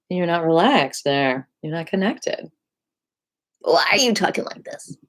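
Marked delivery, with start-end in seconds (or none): 3.6-5.0 whispery, voiceless hoarse demonstration. Shown as a demonstration. Voice teacher questioning a disconnected student, imitated hoarse whisper. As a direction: imitate someone who has lost their voice. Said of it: "You might have a hoarse voice".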